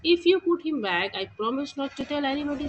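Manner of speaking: put-on light girl voice making a promise; girl's voice. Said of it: "says the girl".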